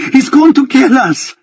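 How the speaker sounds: high, terrified cry; terrified scream. A narrator's reading. Benjamin screaming in terror at the others on the roof. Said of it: "screams Benjamin".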